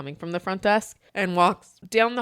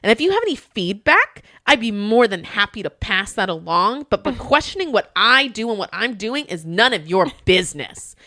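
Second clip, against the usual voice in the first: going off; 3 semitones higher, 7 dB louder than usual. Retold or imitated. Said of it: "And went off on him".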